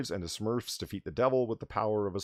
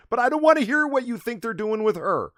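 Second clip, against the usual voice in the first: exasperated outburst; 13 semitones higher, 10 dB louder than usual. Real, not acted. Exasperated protest.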